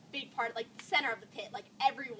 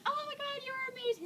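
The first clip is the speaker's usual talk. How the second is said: very high, gushing praise; gushing admirer; 12 semitones higher, 3 dB quieter than usual. Retold or imitated. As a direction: imitate a girl squealing with admiration. Retells nice girl gushing.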